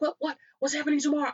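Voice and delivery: fast, high panicked questioning; frantic. Retold or imitated. Deli worker overhearing, asks in a frantic panic. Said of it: "he got into this frantic".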